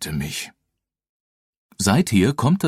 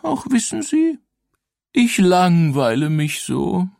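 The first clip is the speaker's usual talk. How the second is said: high, whiny ghost voice; weeping ghost; 11 semitones higher than usual. Acted. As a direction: whine like a bored ghost. Ghost complains of boredom.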